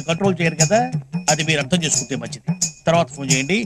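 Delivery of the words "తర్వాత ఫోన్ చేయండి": ordinary talk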